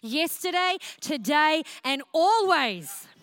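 rally yourself out of pity city; preacher commanding her own soul to praise; pep talk to self. Shown as a demonstration. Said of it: "a discipline to say".

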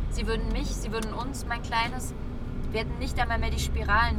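ordinary delivery

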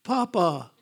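lightly voiced baby calling grandpa; hint of a baby voice, falling in pitch; imitated baby granddaughter. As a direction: play a newborn greeting grandpa, lightly. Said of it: "it's almost like she's going".

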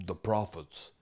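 ordinary talk

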